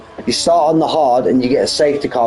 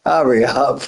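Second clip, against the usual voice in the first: usual speaking voice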